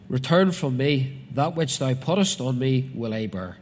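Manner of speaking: loud, measured Bible reading with drawn-out phrases; declaimed scripture, names punched out. Acted. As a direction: proclaim the verse to the congregation. Reads scripture aloud to congregation.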